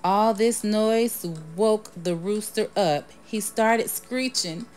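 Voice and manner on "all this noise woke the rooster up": ordinary delivery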